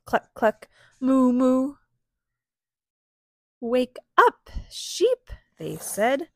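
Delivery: animated reading with stretched animal words; story read-aloud. A narrator's reading. Parent reads sheep and pig scene, animated storytelling. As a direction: perform the farm story for little children. Reads picture book to children.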